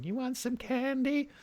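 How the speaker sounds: put-on high, soft, slow child voice; little boy. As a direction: play a small boy from the film. Acting the little boy scene.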